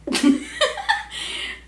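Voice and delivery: shrill giggle; real laugh; high burst of laughter. Real, not acted. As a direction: break up laughing. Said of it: "how to explain it".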